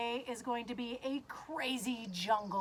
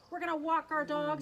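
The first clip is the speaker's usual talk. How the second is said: normal talking voice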